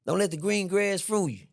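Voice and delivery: usual speaking voice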